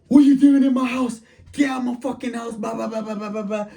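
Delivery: high-pitched irate rant; mock furious father throwing people out; acted angry dad. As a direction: rush into a scolding, tailing into blah-blah. Father storms in, throwing them out.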